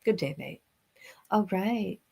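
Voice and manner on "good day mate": a softer, lower "Good day, mate" with a light Australian twang; mock Aussie greeting. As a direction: repeat the Australian greeting quietly. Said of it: "I always gotta say that to her".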